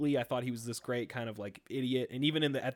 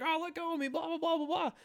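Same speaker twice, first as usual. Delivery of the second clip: much higher, panicky robot squeal; Wheatley pleading. Retold or imitated. Friend imitating Wheatley begging to be released, high squeal. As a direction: squeal it like a robot sucked into space. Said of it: "he's like".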